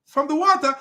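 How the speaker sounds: high, hurried mock boy; boy's voice. Boy trusting the mountain over the boat, high mock voice. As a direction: squeeze it up high, mocking the son. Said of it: "The boy says".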